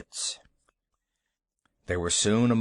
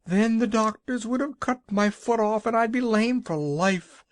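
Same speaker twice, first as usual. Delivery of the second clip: high, tearful lament; wailing on; 10 semitones higher, 3 dB louder than usual. A narrator's reading. Woman laments to the Shaggy Man. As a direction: keep wailing the worst case.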